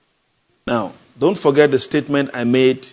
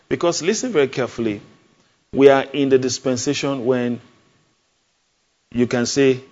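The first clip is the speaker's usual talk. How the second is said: ordinary talk, nothing marked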